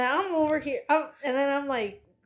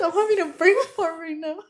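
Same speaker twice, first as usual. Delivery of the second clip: usual speaking voice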